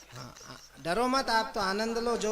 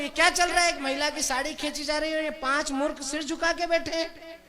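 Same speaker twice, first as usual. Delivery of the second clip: higher, indignant acted demand; angry outburst; 6 semitones higher, 3 dB louder than usual. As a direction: act out naive outrage at the scene. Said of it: "उसको गुस्सा आया".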